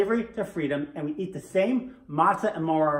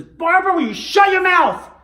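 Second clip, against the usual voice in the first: mock angry yell; 11 semitones higher, 11 dB louder than usual. Red-faced husband silences his wife before the officer, hammed-up angry yell. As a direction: explode as the fed-up husband in a joke. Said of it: "does he always talk to you that way".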